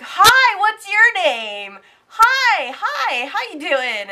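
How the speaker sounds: high, loud, overeager greeting; eager teen boys. Retold or imitated. Teen boys on the plane greet her, loud and eager. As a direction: gush like a pushy teen boy.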